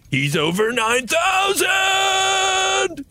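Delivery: drawn-out high anime yell; Vegeta shout. Retold or imitated. Quoting the Vegeta meme, slow high shout. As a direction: stretch it like a screaming anime rival. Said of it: "Vegeta shouting".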